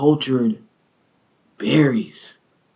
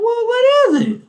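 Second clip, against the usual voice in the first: mock whiny, far higher; high squeaky question; faster than usual. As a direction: squeal it in disbelief. Baffled follow-up question.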